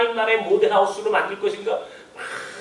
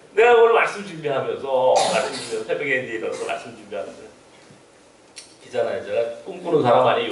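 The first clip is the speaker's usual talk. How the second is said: ordinary talk, nothing marked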